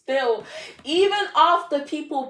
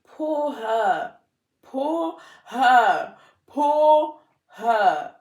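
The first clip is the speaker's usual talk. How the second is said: from plain to higher, louder hammed-up pity; building mock pity; 4 semitones higher, 5 dB louder, much slower than usual. Pitying her past self, a mock lament that builds each time. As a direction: say it three times, pushing each one up.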